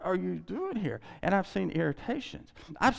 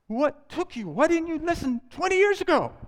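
higher, louder mock exasperation; resentful person scolds a converted spouse, mock exasperated; exasperated demand; 12 semitones higher, 6 dB louder than usual. Mock exasperated outburst. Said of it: "I've actually heard people say".

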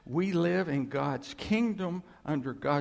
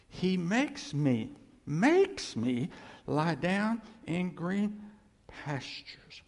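slow, higher, louder psalm read; slow scripture reading; 4 semitones higher, 4 dB louder, slower than usual. Acted. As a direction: read the psalm slowly, swelling with reverence. I'm gonna read it slowly.

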